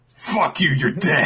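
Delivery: high, hammed-up snarl; playful tough-guy threat; mock threat. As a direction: brother threatened over wasted food, hammed-up snarl. Sanji threatens his brother.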